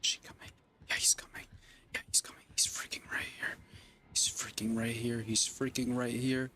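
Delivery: tense whisper while hiding. Real, not acted. Streamer hiding from the killer, whispering. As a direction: breathe the words so he can't hear.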